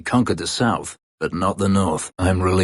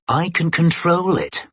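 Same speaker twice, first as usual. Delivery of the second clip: high mocking echo of a mage's boast; sneering parody of a mage's boast; mocking quote; 10 semitones higher than usual. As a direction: throw their own words back, sneering. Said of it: "Wasn't that what you said?".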